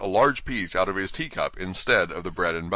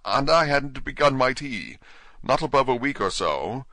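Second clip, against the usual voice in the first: trembling voice; 6 semitones higher, 3 dB louder than usual. Acted. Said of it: "in a trembling voice".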